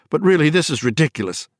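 on the edge of hysteria; hysterical thought. A narrator's reading. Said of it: "she thought hysterically".

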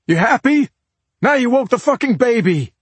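high, loud, fed-up yelling; furious shout at a partner; angry yell. A narrator's reading. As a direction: yell it in accusation, patience gone. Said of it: "yelled out".

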